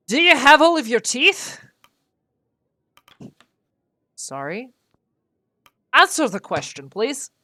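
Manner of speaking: loud, high barking sea captain; a blustering captain demanding an answer; captain's terrible accent. Acted. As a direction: bellow it like a gruff old captain. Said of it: "Pardon for my terrible accent".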